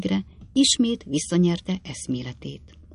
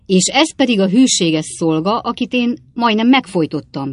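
loud, higher outburst; exclaiming; 6 semitones higher, 7 dB louder than usual. A narrator's reading. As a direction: shout in outrage. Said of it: "kiáltott fel Ben Allen úr". (felkiáltva, felháborodottan)

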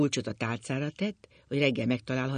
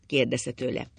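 ordinary delivery

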